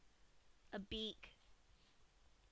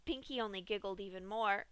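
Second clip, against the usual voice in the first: plain reading voice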